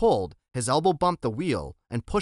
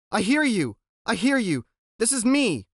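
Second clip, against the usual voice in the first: eager relief; 8 semitones higher, 3 dB louder than usual. Acted. Boy answers radio eagerly.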